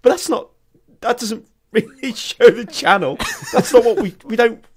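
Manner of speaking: high, stumbling mock protest; retold bewilderment, pitch leaping up; flustered disbelief. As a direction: splutter half-finished objections, amused. Retelling flustered disbelief at podcast.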